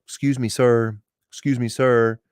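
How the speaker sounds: polite wake-up call. Shown as a demonstration. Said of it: "You don't bang on it like that".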